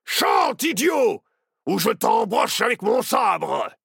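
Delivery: loud, high pirate bellow; furious threat. A narrator's reading. Captain threatens Barnabé to make him sing, furious.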